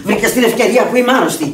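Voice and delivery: high, loud and fast, spitting fury; raging tirade. Acted. Sick woman raging at the friend who robbed her. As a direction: rant at the thief nonstop. Accuses friend of theft, rages.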